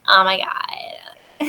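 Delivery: put-on slow, whispered Valley Girl oh my god. Brits on holiday mocking Americans, whispered Valley Girl gasp. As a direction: drag it out breathily like a mocking Brit. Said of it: "the Valley Girl impression".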